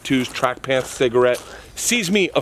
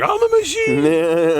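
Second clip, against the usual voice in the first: slow, louder proud declaration; proud boast; 5 semitones higher, 5 dB louder, much slower than usual. Retold or imitated. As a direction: announce yourself like a legend. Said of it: "And I was like".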